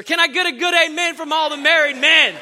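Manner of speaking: high, loud preacher call; loud call for amens. Real, not acted. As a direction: fire up the married men. Rallying men for amens.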